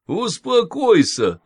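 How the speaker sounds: sugary soothing old nanny, lightly voiced; cooing nanny. A narrator's reading. Nanny soothing the patient, a mild cooing voice. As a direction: coax her like a sweet old nurse. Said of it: "заувещевала нянька".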